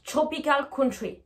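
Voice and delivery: TROPICAL COUNTRY as "chropical counchry"; TR as CHR. Shown as a demonstration. Teacher applying the rule, TROPICAL COUNTRY with chr. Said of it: "vous devez prononcer C H R".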